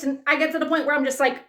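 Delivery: usual speaking voice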